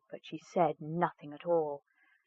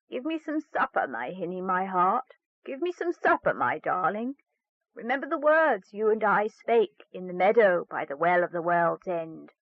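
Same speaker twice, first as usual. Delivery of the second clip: frog's coaxing plea. Acted. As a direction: wheedle for supper like a frog. Frog asks supper.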